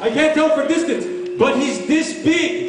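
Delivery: high voice, stretching this big slowly. Retold or imitated. Father telling the driver the distance, high and slow.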